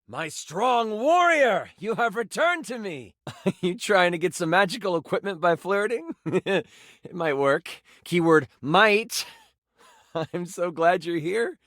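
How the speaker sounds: playful, laughing flirt; flirty character. Acted. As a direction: tease the hero who flirts back. Flirty character greets player.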